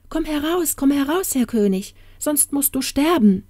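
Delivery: high-pitched, pressing warning call; urgent calling out. Acted. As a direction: call him out as if his life depends on it. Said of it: "rief sie".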